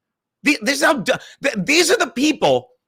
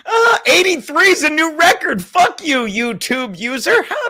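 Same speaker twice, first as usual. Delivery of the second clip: high, loud mock sob at the number; mock crying; 9 semitones higher, 3 dB louder than usual. Real, not acted. Reading chat numbers, a loud mock sob. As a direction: wail it like a fake cry.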